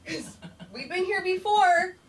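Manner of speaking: high, slow, drawn-out singsong; loud comic caution; mock warning. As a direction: stretch it upward as a knowing reminder. Acted warning to butt slapper.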